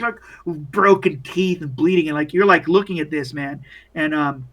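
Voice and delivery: usual speaking voice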